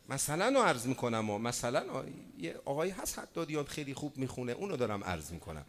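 normal talking voice

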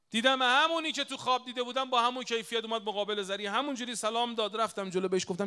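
high-pitched, urgent storytelling; preacher's heightened narration; impassioned sermon storytelling. Real, not acted. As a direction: tell it with pitch lifted high.

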